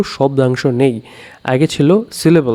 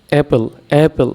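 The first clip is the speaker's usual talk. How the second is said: Apple said twice, weight on app; stress on first syllable. Shown as a demonstration. Teacher demonstrating for clapping exercise, Apple stressed on app. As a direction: lean on app each time in Apple. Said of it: "app হচ্ছে stress syllable".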